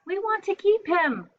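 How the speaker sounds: high, loud pleading shout; excited cry. Acted. Children begging parents to keep crocodile, excited cry. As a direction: beg like eager kids.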